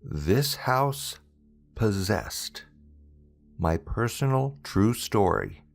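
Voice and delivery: ordinary delivery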